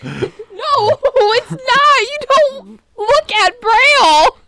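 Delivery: high-pitched, helpless laughing protest. Real, not acted. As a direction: squeal it out through real laughter, dragging the words. Helpless laughter over reading Braille joke.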